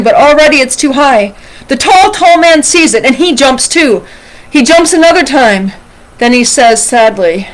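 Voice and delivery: animated read-aloud, lifting with the drama; story reading to children. A narrator's reading. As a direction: read a picture book expressively to children. Reads a picture book aloud.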